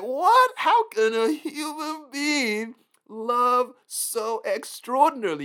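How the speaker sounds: high, loud astonished gasp of a question; stunned and incredulous; amazed disbelief. Pretend. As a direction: sound floored by what you see. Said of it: "You're gonna be like".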